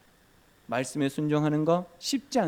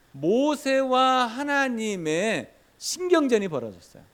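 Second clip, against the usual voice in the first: ordinary delivery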